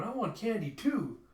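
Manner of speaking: high, drawn-out wanting; pleading demand. Retold or imitated. Pam asking for candy too, pleading demand. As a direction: beg slowly for a treat.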